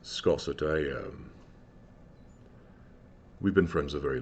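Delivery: a lightly voiced big man asking a favour quietly; large man's voice. Large man asks old friend Scosset for help, low and soft.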